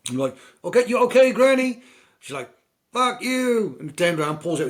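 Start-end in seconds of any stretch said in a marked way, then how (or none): 0.6-1.8 high, loud sing-song greeting; mock friendly call. Passer-by greeting the granny on her rocking chair, high and loud. 2.9-3.8 put-on high, loud snapping old lady; grumpy granny. Film granny snapping at a greeting, high and loud. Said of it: "granny in rocking chair".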